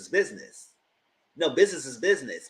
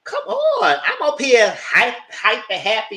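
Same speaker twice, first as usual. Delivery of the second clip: much higher, louder excited greeting; loud high excited girl voice; hyped; 9 semitones higher, 7 dB louder, much slower than usual. Retold or imitated. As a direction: gush like you're hype and happy. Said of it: "I said".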